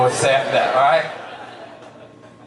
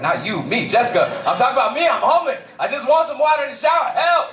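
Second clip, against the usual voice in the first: frantic plea; 8 semitones higher than usual. Retold or imitated. To Jessica after a show, frantic laughing plea. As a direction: beg her like you're desperate.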